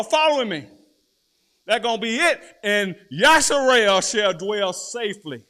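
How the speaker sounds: loud, high, fired-up sermon delivery; full-force preaching. Acted. Preacher to congregation, loud fiery preaching.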